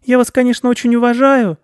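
very high, loud squeaky mouse; humble tiny-mouse voice; sheepish mouse. Acted. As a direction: pipe it up meekly as a little mouse.

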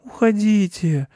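high, slow mournful mouse; sad pleading. A narrator's reading. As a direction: plead it sadly in a small high voice. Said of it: "попросил мышь печально".

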